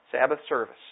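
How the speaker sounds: ordinary delivery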